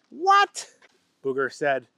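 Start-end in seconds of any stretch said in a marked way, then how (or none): 0.1-0.7 high, sharp astonished question; stunned boss; high shocked boss. A narrator's reading. Owner astonished.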